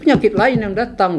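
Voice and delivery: retold warning with mild irritation; a bit annoyed. Patient warning an angry fellow patient, mildly annoyed. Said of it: "Saya agak kesel".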